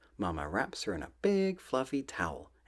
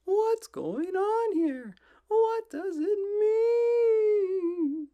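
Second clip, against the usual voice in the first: very high, loud, slow wail; frightened hens fretting; whiny, drawn-out chicken panic; panicky chickens; 8 dB louder, much slower than usual. Acted. Nervous chickens voiced in story.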